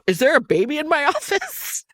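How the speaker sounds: incredulous, pitch jumping up; shocked disbelief. Retold or imitated. Boss on the phone asks about the baby, incredulous. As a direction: boss hearing a baby, stunned. Boss's shocked question, retold.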